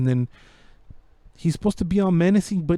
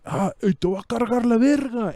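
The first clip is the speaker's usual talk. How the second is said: higher, hammed-up Mexican gangster threat; mock villain threat; 6 semitones higher than usual. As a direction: threaten like a cartoon boss. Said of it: "He's just like".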